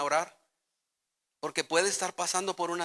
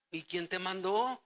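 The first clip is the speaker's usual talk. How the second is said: normal talking voice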